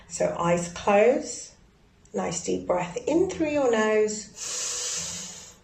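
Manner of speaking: slow, soft breathing guidance; guided breathing. Acted. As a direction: lead them slowly through each breath.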